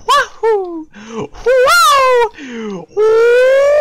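very high, loud drawn-out whoops; high whooping shouts. Real, not acted. Player in a risky game stunt, loud high whoops. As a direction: whoop loudly, far up in pitch.

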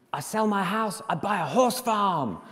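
loud, high Argentinian fan, bursting with news; Argentinian accent. Retold or imitated. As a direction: proclaim it in a hammed-up Argentinian accent. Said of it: "Sorry about the accent".

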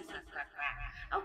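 low, slow, quiet quack, quack, quack; slow low duck quacking. Shown as a demonstration. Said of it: "the duck say".

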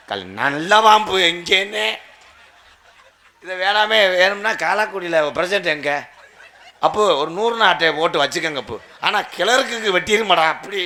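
loud, high comic rant; exasperated outburst. Acted. Suitor complaining to the village crowd, loud high rant. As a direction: project it out to the crowd, worked up. Suitor appeals loudly to the audience.

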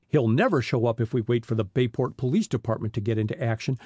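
usual speaking voice

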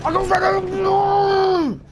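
high frustrated yell; panicking gamer. Real, not acted. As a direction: shout it out in real panic. Gamer losing a kill, yelling.

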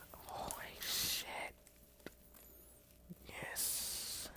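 slow breathless whisper; awed whisper. Real, not acted. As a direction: hush it in awe. Opens package, awed.

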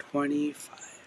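soft, barely voiced aside; near-whisper. Real, not acted. Seller echoing the price to herself, near-whisper. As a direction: let it drop almost to a whisper. Voice nearly gone, price echoed softly.

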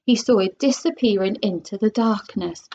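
plain reading voice

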